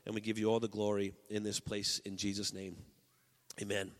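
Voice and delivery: soft, earnest prayer to the Lord. Acted. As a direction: pray quietly over the room. Leads congregation in prayer.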